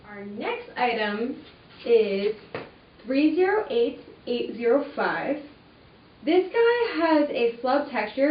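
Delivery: ordinary talk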